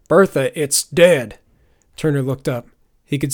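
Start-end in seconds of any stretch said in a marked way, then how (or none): 0.1-1.4 loud, exasperated call to a beast; shouted. A narrator's reading. Sheriff calls to his bear over Turner, shouting. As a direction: call it out forcefully. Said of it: "shouted a familiar voice".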